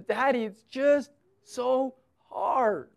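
high, drawn-out imitation of a child's plea; guttural honesty, retold. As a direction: stretch each word as an imitated remorseful little girl. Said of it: "just sheer guttural honesty".

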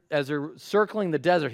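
ordinary talk, nothing marked

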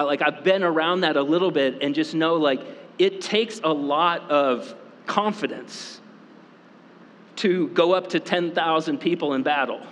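ordinary talk, nothing marked

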